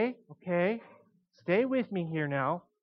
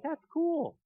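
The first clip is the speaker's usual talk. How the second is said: mock clueless dude; 8 semitones higher than usual. Mocking clueless disciples.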